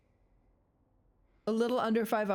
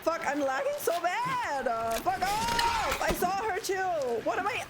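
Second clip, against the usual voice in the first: frantic yelling; 8 semitones higher than usual. Real, not acted. Lagging streamer yells at the monster, frantic and high. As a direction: yell it in a full real panic. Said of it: "from screaming".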